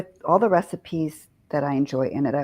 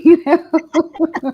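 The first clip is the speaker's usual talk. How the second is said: laughing through it, high and bright; laughing at her own joke; 10 semitones higher, 6 dB louder than usual. Real, not acted. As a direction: break into a giggle. Laughs at her own show name.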